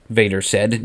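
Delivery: plain reading voice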